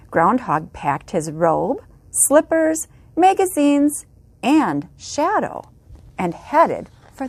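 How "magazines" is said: usual speaking voice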